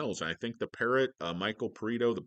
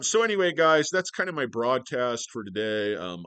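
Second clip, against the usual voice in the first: ordinary talk, nothing marked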